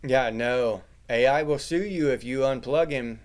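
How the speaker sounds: ordinary talk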